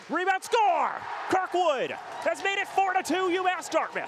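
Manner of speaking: high, loud play-by-play goal call; goal call shout. Real, not acted. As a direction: belt out the goal like an announcer.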